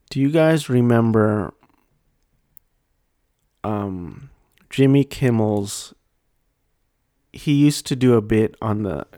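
usual speaking voice

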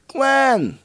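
shouted high, drawn-out name; loud high call. Real, not acted. Calling out to absent co-host.

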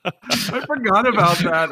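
usual speaking voice

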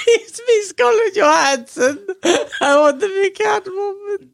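high laughing mock Scarlett Johansson voice; Scarlett Johansson impression. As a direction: squeak it out like a bad Scarlett. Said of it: "that was as close as your Scarlett Johansson".

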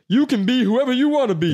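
high loud pumped-up preacher; motivational coach voice. Retold or imitated. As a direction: hype it like a running coach. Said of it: "he's like".

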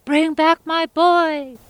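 high, loud and slow desperate cry; hollered. Acted. Old woman yelling at the jackfish, loud slow holler. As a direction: stretch it out, calling across the lake. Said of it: "She hollered at that jackfish".

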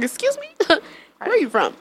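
mock-proper suburbanite, slowing on the question. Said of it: "put my whole suburban voice on".

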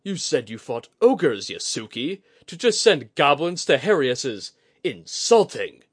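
loud, high, affronted outburst; indignant complaint. Acted. Commander insulted at being sent mere goblins, indignant. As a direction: complain loudly as an insulted warrior.

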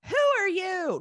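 high, hammed-up questioning; mock suspicion. Father challenging the disguised son, exaggerated high query.

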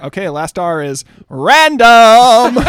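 loud, slow excited announcement. Real, not acted. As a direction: announce it with big energy. Excited announcing final round.

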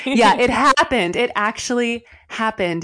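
delighted, still laughing; thrilled it came true; excited. Real, not acted. Joyful laugh at the news.

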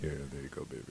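deep, soft sultry croon. Pretend. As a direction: purr it low like a soul crooner.

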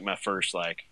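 ordinary talk

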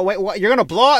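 loud, high disbelief; a loud, high mock shock; shocked shout. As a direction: yell in outraged disbelief. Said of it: "And I go".

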